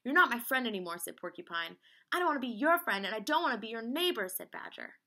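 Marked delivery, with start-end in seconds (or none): 0.0-1.0 loud, higher-pitched hurt retort; acted indignant outburst; angry quarrel. Storybook friends' shouted quarrel. 2.1-4.3 loud, high, emphatic retort; acted storybook tantrum; angry quarrel. Storybook friends' shouted quarrel.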